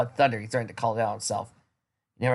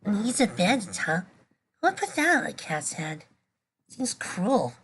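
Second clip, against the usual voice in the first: put-on gently musing girl; 9 semitones higher than usual. A narrator's reading. Girl musing over the cat's bandage. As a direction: wonder aloud over the cat.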